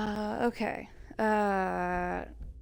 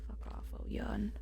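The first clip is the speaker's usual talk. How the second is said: whispering; 7 dB quieter than usual. Real, not acted. Insult to game character.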